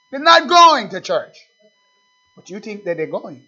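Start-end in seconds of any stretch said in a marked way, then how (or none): 0.1-1.4 much higher and louder, slow; slow loud preacher's proclamation; emphatic declaration. Acted. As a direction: hammer home the refusal.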